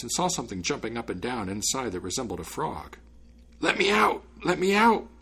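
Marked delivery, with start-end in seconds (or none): 3.6-5.1 high, loud repeated cries; small trapped creature shouting to be freed; crying out. A narrator's reading. Frog-like spirit in the bottle begging release, crying out. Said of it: "it kept crying".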